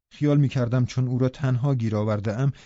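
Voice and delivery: plain reading voice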